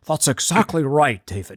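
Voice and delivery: mock British accent impression, lightly done; a hint of English accent, playful; Dominic Sandbrook. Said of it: "He would say it in a better accent".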